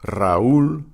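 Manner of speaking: Raúl as ra-OOL, R trilled; rolled R, then a distinct stressed ú in Raúl; separate syllables. Shown as a demonstration. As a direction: split Raúl in two, stressing the ú. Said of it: "it's a separate syllable".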